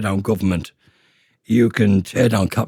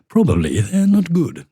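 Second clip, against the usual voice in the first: mock upper-class English accent, higher; hammed-up posh English voice sneering at snobs; a badly faked posh English accent; 6 semitones higher than usual. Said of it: "my really bad attempt at a posh accent".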